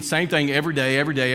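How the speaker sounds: normal talking voice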